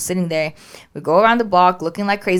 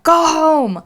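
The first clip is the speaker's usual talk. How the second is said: higher, louder command; urgent order; 10 semitones higher, 6 dB louder than usual. Retold or imitated. Tearful owner tells husband to drive home, loud and urgent. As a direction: order him to drive off now.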